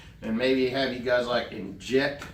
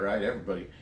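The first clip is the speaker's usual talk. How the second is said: ordinary talk, nothing marked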